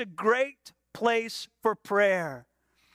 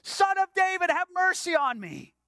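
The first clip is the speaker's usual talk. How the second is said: crying out; 6 semitones higher than usual. Acted. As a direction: call out for mercy, pitch held high. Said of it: "just starts crying out".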